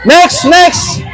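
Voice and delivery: calling out. Real, not acted. Host summoning the next contestant, calling out. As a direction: call the next one over.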